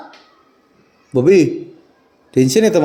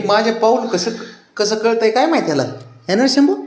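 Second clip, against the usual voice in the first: high pet talk; 10 semitones higher than usual. Real, not acted. Man greeting his excited dogs at the door, high sing-song pet talk. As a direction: gush over the excited dog in a high voice. Cooing to the dog.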